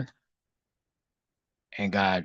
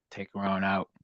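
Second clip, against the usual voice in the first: usual speaking voice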